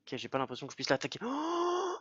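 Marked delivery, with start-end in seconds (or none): 1.2-2.0 very high sudden cry of pain; pained yelp. Real, not acted. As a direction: yelp as the hit lands. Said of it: "ça fait super mal".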